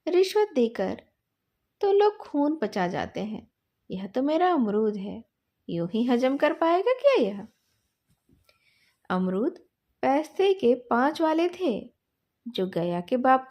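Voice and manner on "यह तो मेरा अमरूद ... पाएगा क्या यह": usual speaking voice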